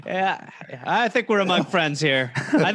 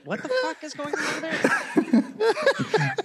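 baffled listener; 11 semitones higher, much slower than usual. Retold or imitated. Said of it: "But you guys are like".

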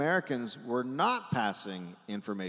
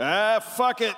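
higher, louder, devil-may-care "fuck it"; careless Australian; 10 semitones higher, 8 dB louder, slower than usual. Retold or imitated. Australians shrugging and calling an election, in a loud reckless voice. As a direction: toss it off like a reckless gambler.

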